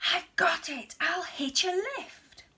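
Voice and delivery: bright burst of a new plan; excited cry. A narrator's reading. Snail announcing her plan, excited. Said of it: "then cried".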